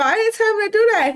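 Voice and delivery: high, fast, loud amazed protest; excited disbelief. Real, not acted. Player amazed her Sim acts alone, excited. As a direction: burst out in surprised delight. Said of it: "player reacts to Carter".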